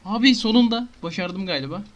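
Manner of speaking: high, loud relieved joy; can't believe it worked; excited. Real, not acted. Gamer celebrating finally making the portal, excited.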